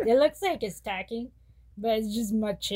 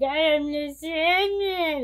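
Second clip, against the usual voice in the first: slow, higher goofy villain line; silly voice; 6 semitones higher, 4 dB louder than usual. Retold or imitated. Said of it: "I was being, like, silly".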